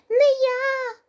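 high, loud squeak; squeaking mouse. Acted. Mouse refuses to knead the dough, high loud squeak. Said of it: "піщить круть".

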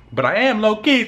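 ordinary talk, nothing marked

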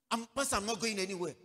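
high, loud, rushed protest; agitated refusal. Retold or imitated. Acting out friend's agitated refusal.

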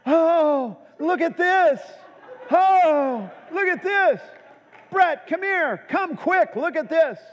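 high-pitched yell, mimicking an excited wife; imitated excited yelling. Wife calls husband to see her find, imitated excited yell. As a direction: call out urgently from another room, playfully exaggerated. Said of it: "she yells for the forty-fifth time".

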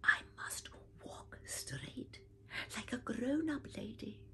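soft unvoiced whisper. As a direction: whisper it to yourself like a secret vow. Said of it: "she whispered to herself".

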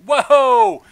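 normal talking voice

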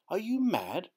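high, startled cry of disbelief; incredulous outcry; cried out. A narrator's reading. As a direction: burst out as if he can't believe it. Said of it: "I cried".

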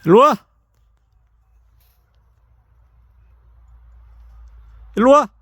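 loud call to the dogs. Real, not acted. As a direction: call them over from a distance. Calling dogs from afar.

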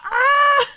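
high loud yelp of pain; mock ouch shout. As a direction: cry out as the plush hits. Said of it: "J'étais là".

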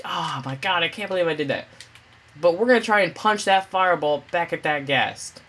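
ordinary talk

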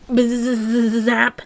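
spoken buzzing sound effect with a long z; electric zap. Acted. Reader voicing the fusion's effect, electric buzz. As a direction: buzz it out, then end on a zap.

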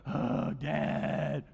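mock groaning kid; imitated exasperated teenager; eye-rolling whine. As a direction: sigh it out like a teen rolling their eyes. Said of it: "They're gonna roll their eyes at you".

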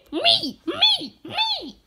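high loud me me me; eager high shout. Real, not acted. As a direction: beg to be picked.

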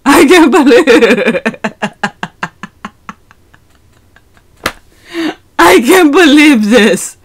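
giggling in shock; laughing, half-crying; laughing disbelief. Real, not acted.

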